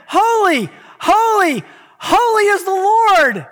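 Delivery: high, loud, drawn-out cry; shouting holy. Retold or imitated. Preacher voicing the cherubim, shouting holy at full voice. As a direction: cry it out like the angels before God. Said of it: "They're shouting".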